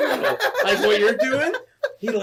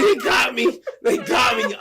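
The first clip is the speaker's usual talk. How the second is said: loud, higher despairing wail; panicked; 6 semitones higher, 3 dB louder than usual. Retold or imitated. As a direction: moan it while pacing. Panicking after the scam call.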